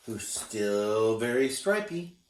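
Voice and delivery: usual speaking voice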